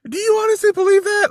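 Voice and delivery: very high, loud disbelief; incredulous. Retold or imitated. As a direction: squeal it in utter disbelief. Incredulous doctor quote.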